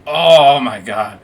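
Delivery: loud, drawn-out stoned amazement; overwhelmed reaction. Retold or imitated. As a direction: stretch it out loud, floored by the hit. Retells being hit by the high.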